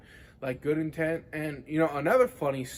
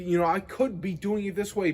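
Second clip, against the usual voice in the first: usual speaking voice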